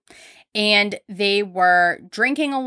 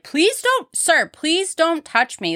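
high, slow pleading voice; high, slow plea to a groping customer; 9 semitones higher, slower than usual. Retold or imitated. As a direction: beg him to stop, pitched way up. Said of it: "shrugged very aggressively away from him and was like".

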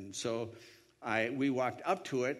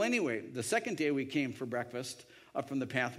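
normal talking voice

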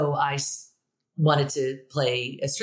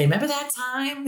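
mock eager email; 10 semitones higher than usual.

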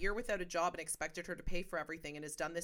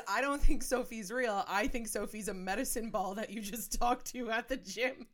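deadpan joke; 5 semitones higher, 5 dB louder, slower than usual. Retold or imitated. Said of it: "I said".

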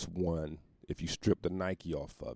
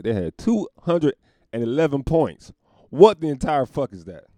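loud, high disbelief; loud outrage; 7 semitones higher, 12 dB louder than usual. Acted. Reading the score, loud outraged disbelief. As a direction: shout it like you can't believe it.

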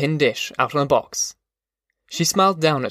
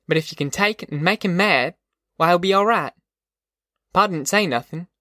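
higher-pitched rural mother explaining to her son; wise, tough mother; 4 semitones higher than usual. Acted. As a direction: confide how to handle a man, assuredly.

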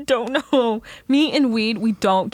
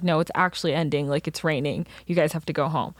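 low calm guard voice; 6 semitones lower, 4 dB quieter than usual. Retold or imitated. Security guards speaking.

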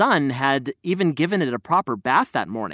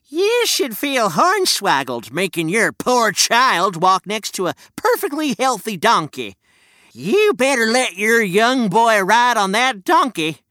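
put-on old prospector; 5 semitones higher than usual. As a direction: lecture like a crusty miner. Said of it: "the prospector".

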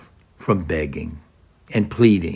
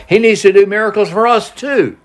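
pitched up high, the hometown crowd demanding a miracle; demanding townsfolk; 9 semitones higher than usual. Retold or imitated. Preacher voicing Galileans who want miracles, high and demanding. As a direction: voice the Galileans asking for their share.

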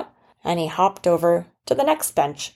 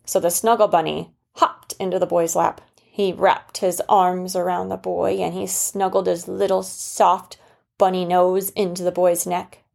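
usual speaking voice

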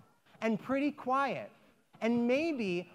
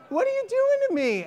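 much higher, fast mock exasperation; exasperated; 4 dB louder, faster than usual. The woman scolding the rabbi, higher fast mock exasperation. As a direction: throw it at him, a touch hammed-up.

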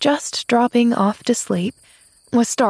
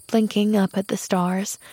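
ordinary delivery

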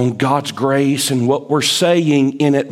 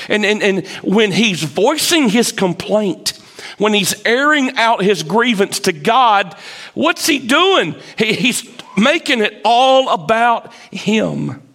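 preaching; 6 semitones higher than usual. Acted. Preaching build to full force.

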